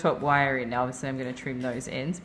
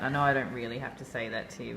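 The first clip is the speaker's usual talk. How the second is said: ordinary delivery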